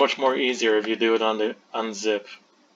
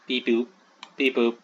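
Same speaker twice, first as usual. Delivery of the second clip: weak droid beep-boop imitation. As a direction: chirp it like a little robot. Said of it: "I suck at doing that impression".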